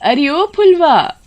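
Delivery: high, drawn-out searching call. A narrator's reading. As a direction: call through the house for your daughter. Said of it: "उसे बहुत पुकारती है".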